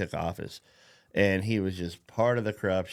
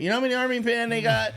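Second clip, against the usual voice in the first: put-on much higher, fast kid voice; childlike; 12 semitones higher, 4 dB louder, much faster than usual. Talking like a five-year-old about army men, high and fast. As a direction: ask it like a wide-eyed five-year-old. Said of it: "like I'm five".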